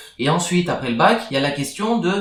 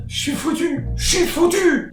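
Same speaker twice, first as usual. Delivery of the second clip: high, whining despair; despairing wail; 13 semitones higher than usual. Acted. Despair after rejection.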